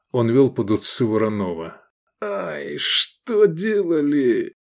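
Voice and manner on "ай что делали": slow, high lament; pained wince. A narrator's reading. Comrade winces at the wounds, pained lament. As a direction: moan it slowly as if it hurts. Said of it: "болезненно сморщился Венет".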